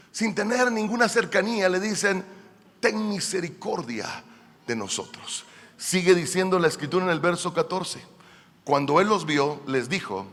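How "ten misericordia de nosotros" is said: hushed acted plea for mercy; subdued, imploring murmur; soft plea. Acts lepers' plea, now hushed.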